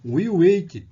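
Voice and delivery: "waited" said loud with a D-like T, "weid"; model pronunciation. Shown as a demonstration. Teacher demonstrating the D-sounding T in "waited". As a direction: punch "waited" loudly, T like D. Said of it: "Então".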